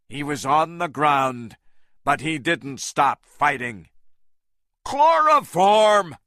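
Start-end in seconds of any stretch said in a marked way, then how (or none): 4.8-6.2 loud, high and slow call; shouted. A narrator's reading. Carl calling to Engelhorn, a slow loud shout. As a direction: stretch the word out in a yell. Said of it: "Carl shouted to Engelhorn".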